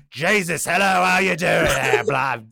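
hammed-up British greeting; British accent. Twin brother greets Jesus, mock British accent. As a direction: hail him in a comic British accent. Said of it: "his, his British twin brother".

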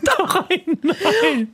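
high, laughing nein. Real, not acted. Co-host laughing in disbelief.

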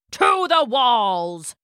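long, drawn-out rallying cry; slow, ringing call to charge the walls; battle cry. A narrator's reading. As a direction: Greek general crying out to his troops. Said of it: "cried Odysseus".

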